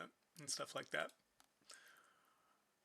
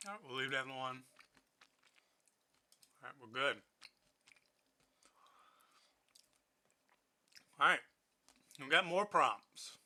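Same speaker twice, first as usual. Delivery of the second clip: talking while chewing chips; crunchy mouthful between words; crunching chips; 4 dB louder than usual. Real, not acted. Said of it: "Sorry for the crunching".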